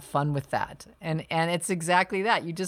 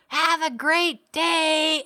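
high, slow, cheery yell from a passing car; retold yell; 12 semitones higher, 5 dB louder, much slower than usual. Stranger yelling from a speeding car, loud and high. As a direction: belt it out like a stranger driving by. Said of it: "this voice yelled".

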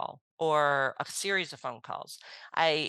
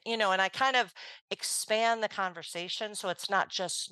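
ordinary talk

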